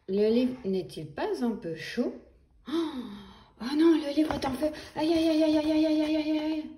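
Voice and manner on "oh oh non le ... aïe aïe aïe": higher, quick alarmed ouches; mock panic. Grown-up burning fingers on the book, higher mock panic. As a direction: yelp at the burning pages.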